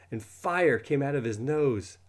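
ordinary delivery, nothing marked

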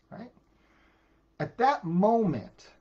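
ordinary talk

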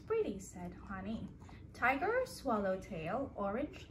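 soft, gentle picture-book read-aloud; reading to a child. Acted. Parent reading a bedtime book to a toddler, gentle storytelling. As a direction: read the story softly to the little one.

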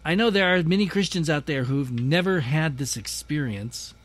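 normal talking voice

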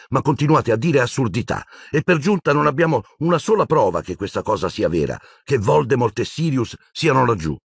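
high-pitched, running protest; exasperated outburst. A narrator's reading. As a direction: argue heatedly against the nonsense. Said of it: "sbottò Hermione". (sbottando, acuta e spazientita)

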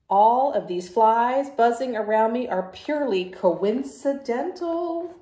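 loud, brash pigeon voice, brushing it off; the pigeon, loud and sure of itself, to the kids; cocky pigeon. Acted. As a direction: play the stubborn pigeon who dodges the bath. Pigeon denying the flies.

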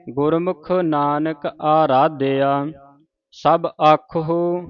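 very slow, rest on Aradhya; pause after Aradhya. Shown as a demonstration. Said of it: "ਆਰਾਧਿਆ ਤੇ ਵਿਸਰਾਮ ਲਾਉਣਾ ਹੈ".

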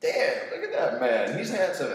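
loud, hammed-up appreciation of your reflection; self-admiring. Teacher admiring himself in bathroom mirror, hammed-up. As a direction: marvel at yourself, pitch leaping up. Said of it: "you've seen me in the bathroom in the mirror".

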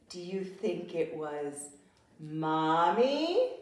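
ordinary delivery, nothing marked

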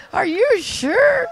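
high, loud and slow disbelief; can't believe what he just said; incredulous. Retold or imitated. Asking the husband in disbelief.

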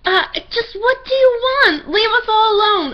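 high, slow, near-crying scream; overacted anime dub. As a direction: shriek at the bear, close to tears. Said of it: "I screamed at him".

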